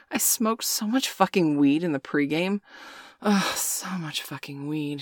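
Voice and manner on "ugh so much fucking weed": grumbling. A narrator's reading. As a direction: mutter it slowly under your breath. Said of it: "she grumbled".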